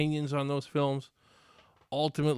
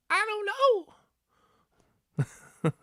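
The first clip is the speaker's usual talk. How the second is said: much higher squeal on I don't, then drop; mock movie reply; 5 dB louder, slower than usual. Mocking the trailer's panicked answer, high squeal. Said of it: "Cringe-worthy dialogue".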